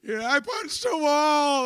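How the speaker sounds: high, loud, exasperated shout; angry self-questioning. Retold or imitated. Man reliving his rage, shouting at himself. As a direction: yell it out at yourself in frustration. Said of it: "I'm like".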